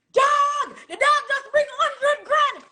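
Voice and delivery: high, loud hype-man shout with a laugh. Retold or imitated. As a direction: hype the crowd like a club DJ on the mic. DJ hyping a big spender.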